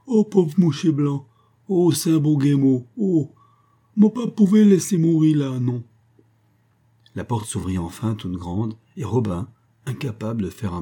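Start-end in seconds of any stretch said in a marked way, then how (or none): 0.0-5.8 put-on high, loud Creole-accented old man, pleading in tears; a distraught old man wailing over a dying stranger; sobbing old Creole leper. A narrator's reading. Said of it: "sanglotait toujours".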